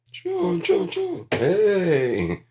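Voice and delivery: very high, loud sitcom call of Sean; shrill-free but high cry across the room calling Sean. Retold or imitated. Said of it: "mock sitcom calling".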